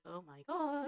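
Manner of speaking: high, soft, drawn-out swoon. Real, not acted. Fan gushing over Theo to viewers, swooning. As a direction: let it float up softly, smitten.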